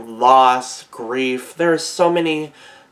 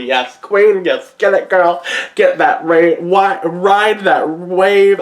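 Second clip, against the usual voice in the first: higher and louder, hyping her up; cheering; 6 semitones higher, 5 dB louder, slower than usual. Real, not acted. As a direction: cheer her on like a fan at the beach. Cheering on a surfer character.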